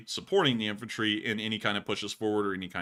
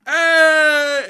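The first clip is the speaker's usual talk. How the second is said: much higher, louder excited hey; loud cheer; 15 dB louder than usual. Real, not acted. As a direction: shout it out in delight. Greeting the donor's phrase.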